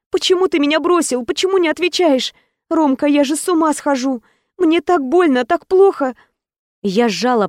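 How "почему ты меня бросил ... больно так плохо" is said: put-on higher, pleading young woman in pain; a desperate girlfriend pleading higher; heroine desperate pleading. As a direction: beg him, voice up high and hurting.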